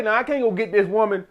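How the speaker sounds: normal talking voice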